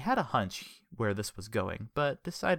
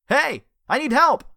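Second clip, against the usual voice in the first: loud, high shout for help; calling for help; 12 dB louder than usual. A narrator's reading. Richard shouting to the police officer, loud high call for help. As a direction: yell out to the cop.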